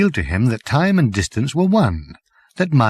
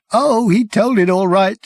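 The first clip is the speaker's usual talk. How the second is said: higher and louder, savage bitterness; 8 semitones higher, 3 dB louder than usual. Acted. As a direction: spit it out savagely. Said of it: "said the man savagely".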